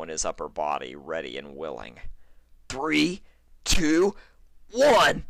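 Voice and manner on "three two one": countdown getting higher and louder; building countdown. Acted. Stallion bracing to push through the window, building countdown. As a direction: psych yourself up, louder each number.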